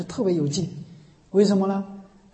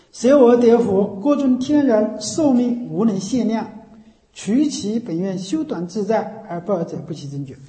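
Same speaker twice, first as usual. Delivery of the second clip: slow, measured sutra recitation, opening loud; scripture recitation; 3 semitones higher, 3 dB louder than usual. Acted. Teacher quotes Amitabha's vow to students, solemn slow recitation. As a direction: recite the vow slowly and emphatically, easing off at the close.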